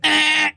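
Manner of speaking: high, short eh like a tiny horn beep; light honk noise. Retold or imitated. Comedian mimicking a light car honk: high little eh. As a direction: squeak it out like a gentle horn. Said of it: "not a full".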